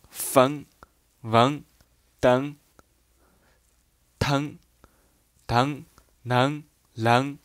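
each example syllable said slowly and separately; dialect readings. Shown as a demonstration. Teacher reads dialect words to the learners, carefully. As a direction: read the dialect sounds out one by one. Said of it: "来读一下".

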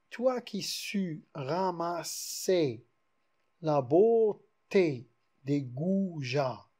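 slow, measured reading of a verse line; verse recited very slowly for the class; poem recital. Shown as a demonstration. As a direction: declaim the alexandrine slowly, weighing each word. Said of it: "voilà un vers alexandrin".

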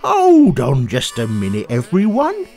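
high, bouncy, delighted; lively frog. Acted. George hears the harmonica, high and excited. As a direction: hear the rhythm and light up.

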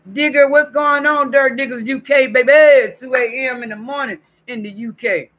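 high, loud, hyped-up greeting; excited shout-out. Real, not acted. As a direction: holler a big hello to an overseas viewer. Calls out greeting to chat member.

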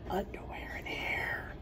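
soft whispered rhyme words. Acted. Reading to children, whispering the rhyming words.

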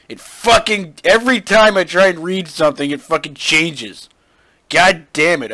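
loud exasperated rant; angry yelling. Real, not acted. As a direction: blow up at the screen. Raging at text that keeps changing.

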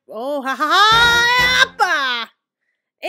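high, loud battle yell; gleeful holler; whooping celebration. Real, not acted. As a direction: whoop that climbs into a shout. Celebration yell during play.

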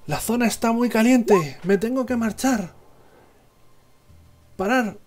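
high panicked shout over the radio; pilot shouting. Acted. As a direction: yell it, under fire. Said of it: "que grita".

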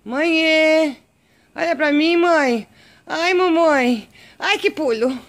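cat owner gushing at her pet; excited cooing. Real, not acted. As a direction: gush over the cat, slowing on mamãe.

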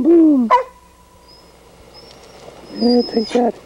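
very high falsetto cartoon animal; pretend pet voices. Dog and cat tussle in play, squeaky falsetto voices.

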